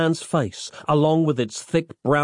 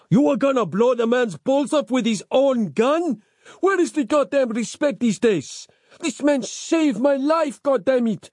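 high-pitched, outraged rant; indignant scolding; 11 semitones higher than usual. A narrator's reading. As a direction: berate them, drawing out the final line. Rescuer berates the waiters.

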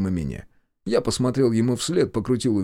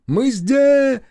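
calling out; 14 semitones higher, 7 dB louder than usual. A narrator's reading. Brother calling from afar.

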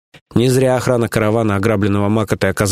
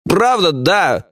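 high, stumbling excuse-making; sheepish underling; 8 semitones higher than usual. Acted. Lieutenant confesses the beating to his boss, defensive. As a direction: admit it reluctantly, then justify with stumbles.

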